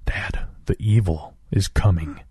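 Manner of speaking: an ominous, emotionless quiet announcement; flat deadpan child. A narrator's reading. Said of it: "with a completely flat voice and deadpan expression".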